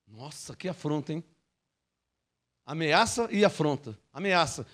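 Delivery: ordinary talk, nothing marked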